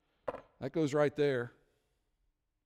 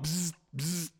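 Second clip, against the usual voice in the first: buzzing sound; 4 dB quieter than usual. Retold or imitated. Imitating phone vibration.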